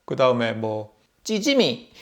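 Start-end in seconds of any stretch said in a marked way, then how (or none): 1.2-1.9 찌지미 said high and bright, set apart; the word 찌지미 lifted high on its own; saying the word. Shown as a demonstration. Vlogger naming a Busan word, word lifted high. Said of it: "찌지미는".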